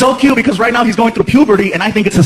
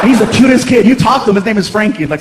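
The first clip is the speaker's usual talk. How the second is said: normal talking voice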